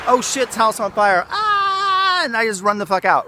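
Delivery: high mock panic with a yelped cry. Mocking an untrained fighter's panic, hammed-up cry. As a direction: squeal it like someone fleeing a burning house.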